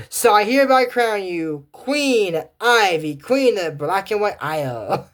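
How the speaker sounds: hammed-up crowning speech; royal proclamation. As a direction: proclaim a coronation grandly. Acted crowning of Queen Ivy.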